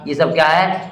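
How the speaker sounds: normal talking voice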